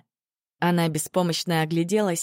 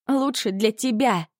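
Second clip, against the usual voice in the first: upset, mildly raised outcry; срываясь на крик; 3 semitones higher than usual. A narrator's reading. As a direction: throw the words back in anger. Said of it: "сорвалась Аня, крича в пустоту". (возмущённый, сдержанный выкрик)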